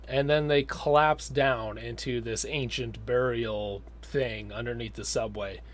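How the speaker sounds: ordinary talk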